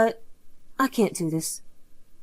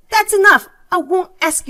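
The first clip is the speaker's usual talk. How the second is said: sharp, much louder and higher cutoff; angry outburst; 7 semitones higher, 7 dB louder than usual. Acted. Insulted sister-in-law ends the argument, angry outburst. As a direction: slam the conversation shut.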